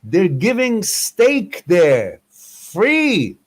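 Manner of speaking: high slow shouted lure to the crowd; hollered announcement. Retold or imitated. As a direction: sell the fake news, drawn out and high. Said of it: "You start yelling".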